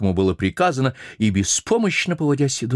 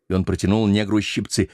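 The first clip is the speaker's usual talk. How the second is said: usual speaking voice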